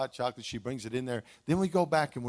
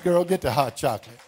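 louder mock bossy husband, quick; playful command; 7 dB louder than usual. Preacher acts his own cheeky order to wife. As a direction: snap it out like a joking order.

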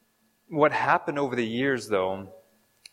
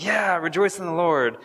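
higher, cheering crowd response; a congregation fired up; eager shout of agreement; excited crowd; 6 semitones higher than usual. Pretend. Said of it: "like, getting excited".

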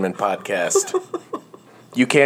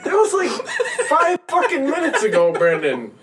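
high squeaky laughing voice; helpless giggles, words squeezed out; 13 semitones higher than usual. Real, not acted.

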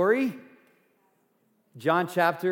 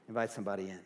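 soft repeat; 6 semitones lower, 10 dB quieter, much faster than usual. Shown as a demonstration.

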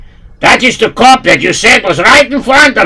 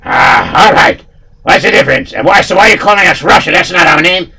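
put-on heavy Russian accent, pitched up; gruff Russian henchmen talking; Russian thug accent; 6 semitones higher, 4 dB louder, faster than usual.

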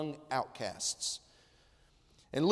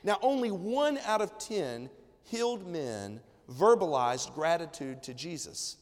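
ordinary talk, nothing marked

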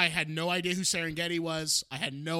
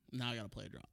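usual speaking voice

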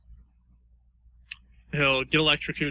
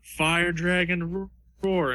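higher, drawn-out anime attack call; slow, raised-pitch battle cry; attack-name battle cry; 6 semitones higher, much slower than usual. Acted. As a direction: call out the special move, stretching it slowly. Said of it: "And then he says".

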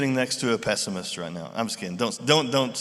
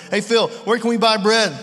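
higher, louder teasing ask; smirking question; 6 semitones higher, 5 dB louder than usual. Retold or imitated. Jesus testing Philip with a sly smirking question. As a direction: needle him with a smirk. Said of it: "asking this question with kind of a smile".

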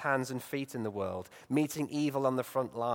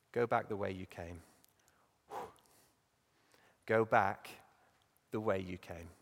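quiet firm command; 6 semitones lower, 5 dB quieter than usual. Acted. God's command, quietly spoken.

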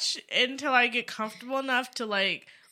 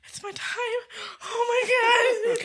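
excited gasp; 11 semitones higher than usual. Retold or imitated. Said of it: "I was like".

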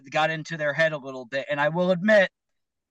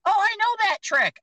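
high, smug heckler voice; mocking heckler; 4 dB louder than usual. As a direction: sneer it like a know-it-all.

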